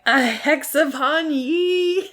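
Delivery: high, slow, eerie curse voice; a hammed-up, laughing witchy curse; spooky hex. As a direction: draw out the curse like a mad old sailor. Buttons hexing Jack, retold.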